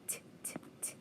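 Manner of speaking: whispered T sounds, no voice. Shown as a demonstration. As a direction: tap out each T in a whisper. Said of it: "teacher sounds out eight".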